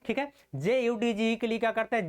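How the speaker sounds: usual speaking voice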